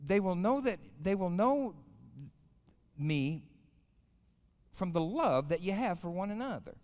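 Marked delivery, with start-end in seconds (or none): none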